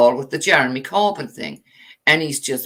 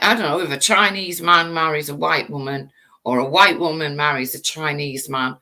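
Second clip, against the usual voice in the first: normal talking voice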